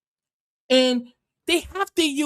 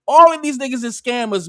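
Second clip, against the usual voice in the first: normal talking voice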